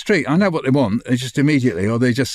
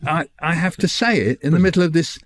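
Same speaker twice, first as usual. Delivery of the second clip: ordinary talk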